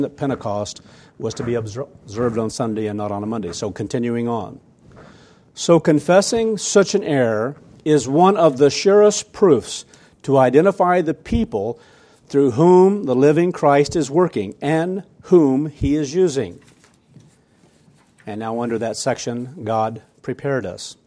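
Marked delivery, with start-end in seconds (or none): 5.5-16.6 loud, deliberate sermon reading; emphatic reading aloud. Acted. Preacher reads a church leader's letter to congregation, emphatic. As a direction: read it out forcefully, weighing each phrase.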